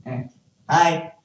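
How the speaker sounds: ordinary talk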